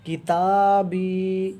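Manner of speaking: کتابی slowly, long a and long i held; کتابی recited slowly with held vowels; long vowels drawn out. Shown as a demonstration. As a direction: stretch each long vowel in کتابی one beat. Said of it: "تو یہ یا مدہ".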